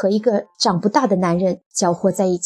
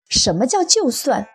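higher, insistent challenge; combative retort; 9 semitones higher than usual. A narrator's reading. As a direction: refuse the concession with indignant pitch jumps. Wife snaps back in quarrel.

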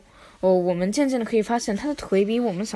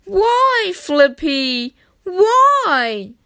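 dismayed plea; 8 semitones higher, 5 dB louder, much slower than usual. Acted. Chief dismayed.

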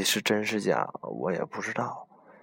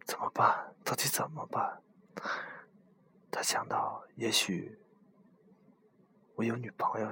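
hushed ghost-story whisper; breathy spooky campfire whisper; whispered storytelling. Acted. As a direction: tell it like a secret at night.

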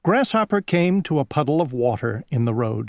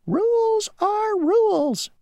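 very high and slow, squeaky and stubborn; the mosquito refusing the grasshopper, high and slow; slower than usual. Acted. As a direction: stubbornly draw out the rule. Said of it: "said the mosquito".

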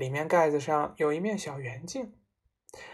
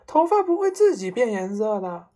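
higher, loud exclamation; shouted retort; 4 semitones higher, 6 dB louder than usual. A narrator's reading. Mother argues with her husband, shouting. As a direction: cry it out in exasperation. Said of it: "妈妈叫嚷着".

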